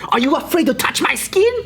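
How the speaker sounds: high-pitched, hammed-up challenge; confrontational. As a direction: imitate an offended woman demanding proof. Same woman confronting him.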